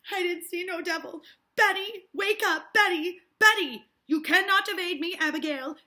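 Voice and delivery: loud, high calls of the name; urgent calling. Acted. Hale calling the sleeping girl and pressing Abigail, loud. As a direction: shout to wake her.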